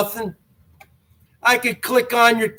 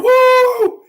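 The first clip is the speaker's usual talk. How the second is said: high, loud joyful woo; ecstatic whoop; 5 dB louder than usual. Real, not acted. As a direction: whoop it out like a revival shout. Ecstatic whoop after baptism tale.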